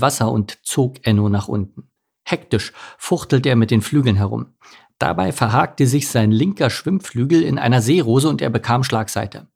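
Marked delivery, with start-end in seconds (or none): none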